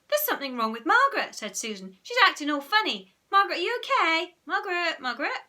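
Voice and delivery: high, loud, fast worry; rising panic in a child's plea; alarmed concern. A narrator's reading. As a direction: call anxiously to an unresponsive friend. Susan alarmed by Margaret's state.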